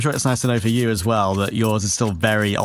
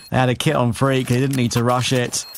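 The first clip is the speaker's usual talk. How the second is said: ordinary talk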